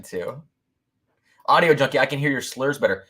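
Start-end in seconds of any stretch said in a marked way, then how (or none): none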